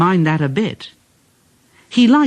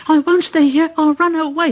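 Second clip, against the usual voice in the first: high anxious engine voice; high fretful puffing rhythm; frightened Percy; 13 semitones higher, faster than usual. A narrator's reading. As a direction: chant it like a scared runaway engine. Percy runs off.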